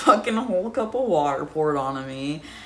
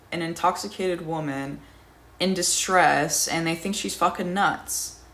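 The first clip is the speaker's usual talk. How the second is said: usual speaking voice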